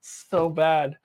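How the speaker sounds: ordinary delivery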